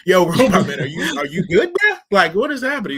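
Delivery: laughing through the words; giggling while asking. Retold or imitated. Friends laughing at glitching robot.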